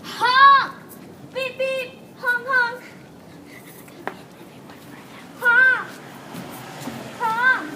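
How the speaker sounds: high loud shouts; shouting honk. Real, not acted. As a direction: yell to the passing cars. Calling cars to honk.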